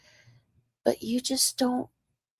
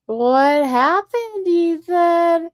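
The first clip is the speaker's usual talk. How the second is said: taunting; 8 semitones higher, 6 dB louder, much slower than usual. Acted. Taunting the caller.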